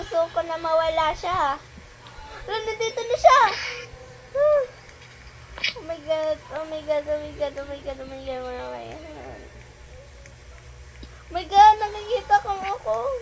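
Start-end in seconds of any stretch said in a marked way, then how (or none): 4.3-4.6 scream. Real, not acted. Monster appears. 5.8-9.6 panicked muttering. Real, not acted. Panicking, fading into fear.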